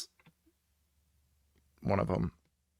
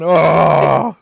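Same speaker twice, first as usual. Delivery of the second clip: loud, drawn-out swooning "oh"; mock swoon groan; 5 semitones higher, 11 dB louder than usual. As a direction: groan loudly in exaggerated admiration. Swooning over actor in suspenders.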